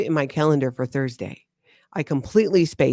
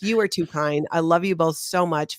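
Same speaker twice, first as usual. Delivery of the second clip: ordinary delivery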